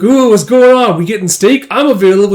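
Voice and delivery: high, loud, eager blurt; excited. Acted. Director angling for an invitation, excited. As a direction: jump in with mock excitement. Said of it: "like, excitedly nearby".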